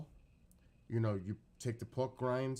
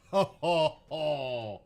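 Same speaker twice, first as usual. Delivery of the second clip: delighted laugh; 5 semitones higher, 10 dB louder, much slower than usual. Real, not acted.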